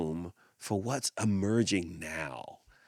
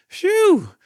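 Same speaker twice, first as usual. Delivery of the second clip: relieved phew; 11 dB louder than usual. Acted. Elephant relieved.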